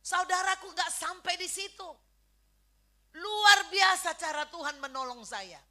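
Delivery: emphatic testimony from the pulpit; forceful preaching. Real, not acted. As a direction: call out to the congregation with conviction. Preacher calls to congregation.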